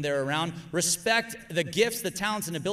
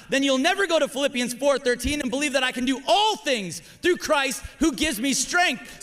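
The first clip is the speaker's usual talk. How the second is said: ordinary talk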